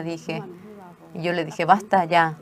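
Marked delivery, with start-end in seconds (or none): 1.6-2.4 sharp, much louder order to stop crying; stern scolding. Retold or imitated. Mother tells crying daughter to stop, stern loud. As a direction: snap at her firmly, loud. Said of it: "me puse seria".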